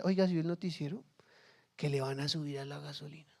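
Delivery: worried neighbour sharing gossip quietly; hushed gossip. Retold or imitated. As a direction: lean in and murmur the rumour. Neighbour spreading bad news.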